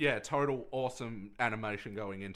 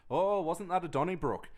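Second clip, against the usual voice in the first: higher, louder Apu impression with an Indian accent; a hint of an Indian-accented Apu; Apu's accent; 6 semitones higher, 3 dB louder than usual. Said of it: "I can't believe you would say it like that".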